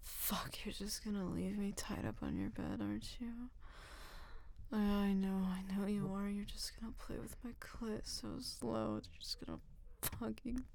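soft, unvoiced pleading; whispered begging. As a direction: beg quietly, almost breathless.

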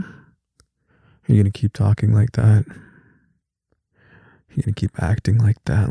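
ordinary talk, nothing marked

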